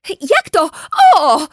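high, loud outraged question; shocked, outraged cry; indignant disbelief. Acted. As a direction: protest in disbelief. Indignant girl protests mother.

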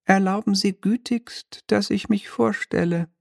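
high, mannered little devil voice; a smug minor demon, lightly shrill and polite; oily demon clerk. Acted. Devil's envoy introduces himself.

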